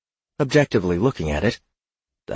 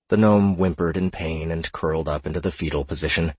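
plain reading voice

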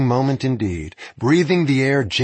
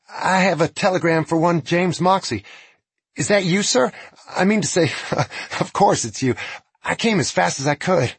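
put-on nervous young messenger; 8 semitones higher than usual. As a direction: babble nervously to the outlaw. Nervous messenger addresses outlaw.

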